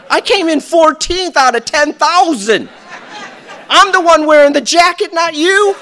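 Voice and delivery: loud, high, laughing boast; cocky girl. Retold or imitated. Girl bragging to the doubter, laughing loud. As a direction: gloat about the jacket. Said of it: "You tell yourself that".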